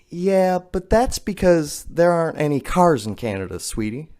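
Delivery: imitated teacher. Teacher setting a pupil straight about Canada, imitated condescension. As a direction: imitate a teacher correcting a child, dropping on the last word. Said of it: "To which my teacher responded".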